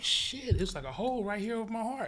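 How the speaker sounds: replaying the moment he saw the hole; alarmed exclamation. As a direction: blurt it out as if just finding the wound. Discovering the bullet hole.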